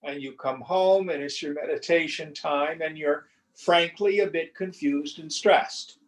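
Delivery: usual speaking voice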